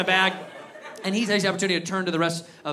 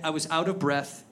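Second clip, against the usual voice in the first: usual speaking voice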